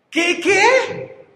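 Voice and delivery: high incredulous double question; mock disbelief. Repite más alto, incrédulo. (incredulidad fingida muy aguda)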